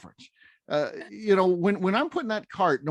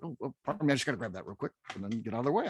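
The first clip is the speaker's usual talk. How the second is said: softer, quick apologetic murmur; gentle apologetic aside, quickened; polite and soft; 7 semitones lower, 8 dB quieter, much faster than usual. Retold or imitated. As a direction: slip past with a soft sorry. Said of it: "I'm just like".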